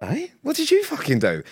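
high-pitched mock confrontation; retold outrage. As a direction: replay an indignant challenge, pitched high. Retelling his own outraged confrontation.

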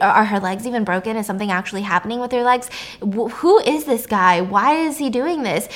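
ordinary talk

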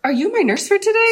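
high, bright-eyed questioning lilt; imitating a parent. Doctor mimicking parents who take her for nurse, high lilt. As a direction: chirp it like an assuming parent. Caller voices patients' parents.

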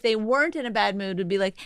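usual speaking voice

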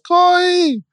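put-on a toddler echoing her mother's call in a tiny high voice; baby copying the call. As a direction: mimic a little child echoing the name. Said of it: "And Sarai says".

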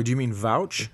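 normal talking voice

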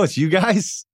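high, mock-stunned question; incredulous. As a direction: sound like you can't believe this is it. J T doubting the tiny show.

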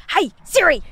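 mock yell at Siri; barked at Siri. Shown as a demonstration. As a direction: call it out to the phone. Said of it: "Do you just go".

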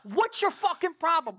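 high-pitched, exasperated demand; imitating pissed-off anger. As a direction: act out a colleague losing his temper. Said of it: "Lou's fucking pissed".

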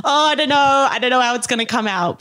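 mimicking own recorded voice. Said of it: "hear your own voice and just be like".

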